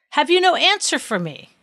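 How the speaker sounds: high-pitched, insistent question; demanding. A narrator's reading. King pressing the silent judge, demanding. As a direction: press hard for an answer.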